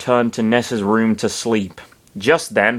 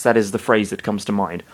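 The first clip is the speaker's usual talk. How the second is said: ordinary delivery, nothing marked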